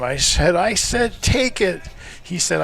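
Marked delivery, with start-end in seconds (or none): 0.6-1.8 high-pitched retold bark at a player; mock bark. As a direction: bark it as a storyteller would, not at full volume. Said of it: "I bark at him".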